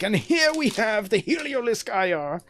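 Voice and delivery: grand wildlife narrator intro; nature documentary. Retold or imitated. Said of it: "As I blow my vocal cords out, trying to impersonate a legend".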